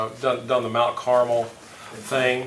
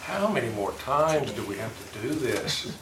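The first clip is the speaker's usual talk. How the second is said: weary, worn-out quote, a little softer; exasperated sigh; 3 semitones lower, 11 dB quieter than usual. Retold or imitated. Leader imitating a fed-up believer, softly exasperated. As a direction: sound fed up with doing it over and over. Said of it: "You ever been exasperated when you go".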